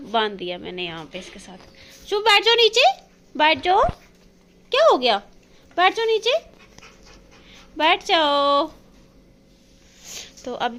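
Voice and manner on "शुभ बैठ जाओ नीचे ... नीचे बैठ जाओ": high, loud doggy commands; high pet-talk orders to the dog. Real, not acted. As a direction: coax the puppy to sit down.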